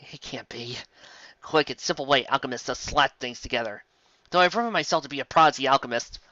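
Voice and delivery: ordinary talk, nothing marked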